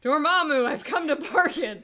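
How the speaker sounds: loud, high heroic declaration; dramatic movie quote. Pretend. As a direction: proclaim it like a movie hero. Quoting Doctor Strange line.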